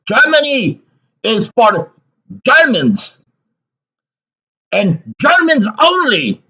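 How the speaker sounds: high, slow demagogue declamation; mock rally slogan. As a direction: proclaim it like a rally speaker, pitched far up. Mock rally echo of quoted slogan.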